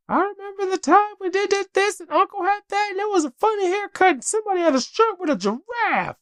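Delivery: put-on very high chattering child voice; four-year-old daughter. Dad imitating his four-year-old listing random memories, very high child voice.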